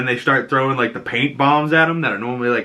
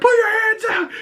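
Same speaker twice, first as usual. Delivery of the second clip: mock yell. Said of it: "and he's like".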